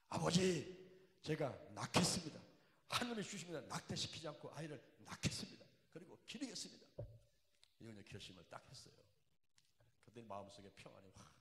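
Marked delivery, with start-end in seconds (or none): 0.1-6.9 fervent cry. Retold or imitated. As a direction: cry out a vow to God. Retold vow to God. (하나님께 크게 부르짖는 서원)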